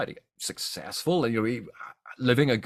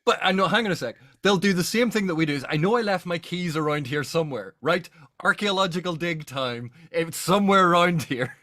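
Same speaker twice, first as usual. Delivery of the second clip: higher, playful voice of an immortal hunting lost keys; mock immortal digger; 7 semitones higher than usual. Joking with the co-hosts about undead archaeologists, a playful mock voice. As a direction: ham it up like a forgetful ancient creature. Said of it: "They're going".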